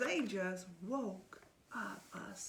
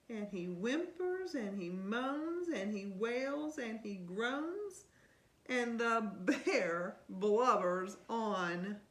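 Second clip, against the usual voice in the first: put-on lively read-aloud to a child; storybook reading; 5 dB louder than usual. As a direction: read it like bedtime story time. Reading picture book aloud.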